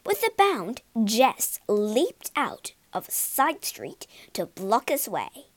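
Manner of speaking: usual speaking voice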